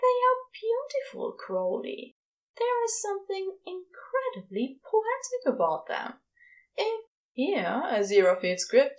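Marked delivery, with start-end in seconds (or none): 0.0-6.2 high awed angel voice; Aziraphale's voice. A narrator's reading. Aziraphale marvelling to Crowley, in a high awed voice. 6.8-7.1 high awed angel voice; Aziraphale's voice. A narrator's reading. Aziraphale marvelling to Crowley, in a high awed voice.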